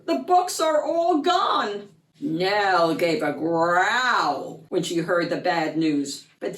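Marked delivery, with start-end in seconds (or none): 0.1-1.9 alarmed cry. Acted. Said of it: "cried out Peter".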